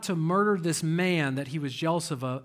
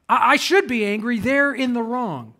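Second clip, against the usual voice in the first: higher, louder, mock-indignant excuse; indignant self-justification; 4 semitones higher, 6 dB louder than usual. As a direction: protest it like someone defending their temper. Acting out people justifying their anger.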